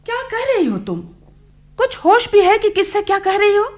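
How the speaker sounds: high, sharp rebuke; shocked scolding. Acted. Mother scolding daughter for insulting the doctor, sharp high rebuke. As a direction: scold her for speaking so rudely.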